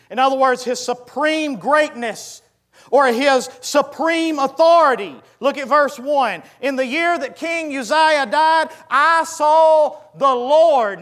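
high, loud scripture declamation; ringing out each phrase, high and forceful; full-force preaching. Real, not acted. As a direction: proclaim the warning to a congregation.

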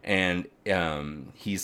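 ordinary talk

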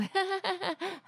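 high, imitated awkward giggle; embarrassed laugh. Imitating friend embarrassed by husband's remark, embarrassed laugh. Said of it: "like, embarrassed".

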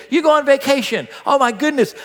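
thrilled at an unexpected treat; excited delight. Acted. Someone given what they always wanted, excited. Acting joy at a dream gift.

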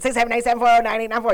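rapid-fire phone number; like an auctioneer. Shown as a demonstration. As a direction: rattle the digits off auctioneer-style. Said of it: "he said it like an auctioneer".

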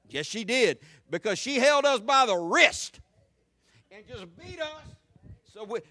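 loud, rising preacher's cadence building to a shout; full-force preaching. Acted. Preacher hammering the congregation, loud and rising. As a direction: preach it hard to the whole church.

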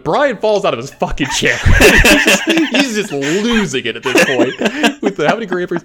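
laughing too hard to get the story out. Real, not acted. Recalling his friend losing it.

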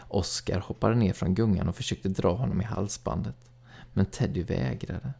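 ordinary delivery, nothing marked

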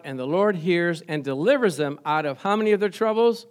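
ordinary talk

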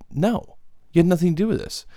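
high, fast burst of disbelief; fast, high-pitched comic exasperation; exasperated outburst. Acted. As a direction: blurt it out, pitch jumping up. Rant outburst at fans.

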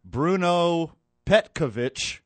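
Bruno Petkovic drawn out slowly, pitched high; careful name pronunciation. Real, not acted. Host attempts a tricky Croatian name, Bruno Petkovic said slow and careful. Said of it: "I'm gonna screw this up".

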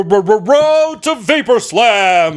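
drawn out. Acted. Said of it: "dramatic stretched title".

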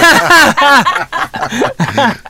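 high-pitched helpless laugh; burst of laughter. Real, not acted. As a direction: crack up after fumbling an introduction. Host bursts out after name slip.

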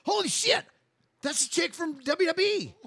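high, loud mock astonishment; retold amazed exclamation. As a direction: blurt it out like you just spotted a celebrity. Retelling spotting the wrestler.

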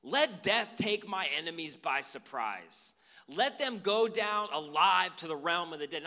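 slow and loud, each curse weighed out; slow, loud, earnest prayer of vengeance; fervent imprecatory prayer. Pretend. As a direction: pray it like a solemn curse, slowly, full voice. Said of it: "started praying like this".

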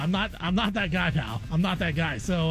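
normal talking voice

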